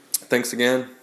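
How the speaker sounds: ordinary delivery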